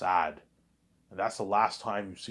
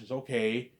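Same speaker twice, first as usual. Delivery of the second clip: usual speaking voice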